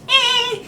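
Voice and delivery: high-pitched falsetto squeal; loud, very high mock sung note. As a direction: imitate a disco falsetto on one short "eh". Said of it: "it's like that high-pitched".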